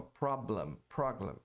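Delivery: "Problem" said with a clear English PROB-lem; sounds like problem. Shown as a demonstration. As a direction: show how program gets misheard as "Problem". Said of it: "soa como problema".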